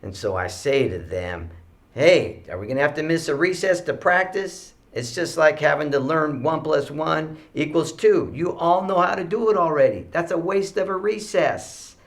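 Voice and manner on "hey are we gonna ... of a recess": exasperated teacher. Retold or imitated. Teacher urging noisy class, retold.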